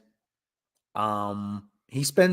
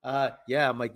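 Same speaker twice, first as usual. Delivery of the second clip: ordinary talk, nothing marked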